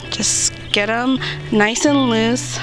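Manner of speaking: usual speaking voice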